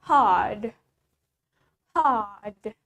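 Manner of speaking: "hard" with the R dropped, like "hahd"; long open A in "hard", no R sound; British unclear R. Shown as a demonstration. Teacher modelling British "hard" with silent R. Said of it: "In British, the R is unclear".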